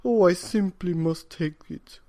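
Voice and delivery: put-on can't resist, old man exclaiming; eager old man. A narrator's reading. Old man spots a tiger-striped cat, eager cry. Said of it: "cried the very old man".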